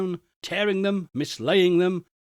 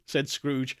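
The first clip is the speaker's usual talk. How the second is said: ordinary delivery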